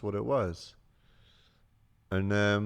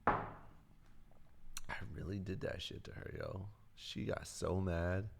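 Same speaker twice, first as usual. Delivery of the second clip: slow, breathy whisper; 12 dB quieter, slower than usual. Real, not acted. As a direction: whisper it slowly into the mic. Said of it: "A S M R".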